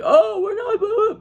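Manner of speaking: very high sneering mockery of them; mock whiny excuse. Mocking the rivals as they dodge the blame, high whiny voice. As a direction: squeak out their excuses mockingly.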